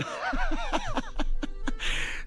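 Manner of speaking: very high, drawn-out laugh; real high-pitched laughing fit; cracking up. Real, not acted. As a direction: burst into helpless high laughter. Cracks up at a remembered joke.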